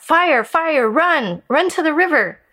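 high, loud alarm call; crying out. A narrator's reading. Parrot warning the forest animals, high loud cry. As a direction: raise the alarm to the whole forest. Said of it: "she cried out".

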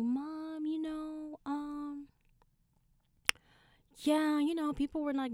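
high, stalling, sheepish voice; hesitant confession to mom. Retold or imitated. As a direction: stall on a high note before admitting it. Retold hesitant call to mom.

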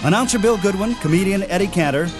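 ordinary talk

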